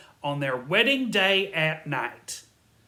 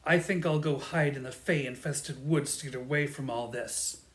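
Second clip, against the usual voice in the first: ordinary talk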